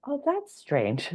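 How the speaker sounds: drawn-out curious wonder; mild puzzled surprise. Pretend. Calmly reacting to the bank's repeat mistake, slow puzzled surprise. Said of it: "I feel like".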